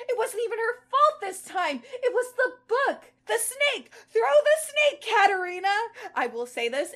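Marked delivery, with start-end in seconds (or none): 0.1-3.9 higher and louder, building alarm; getting more and more worked up; rising panic. Acted. Panicking about the book's plot. 4.1-5.7 higher, louder, yelling at the heroine; urgent shout. Acted. Urging the character to act.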